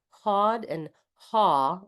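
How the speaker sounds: hawed and haw, slowly drawn out. Shown as a demonstration. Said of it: "Not quite sure the difference between those two".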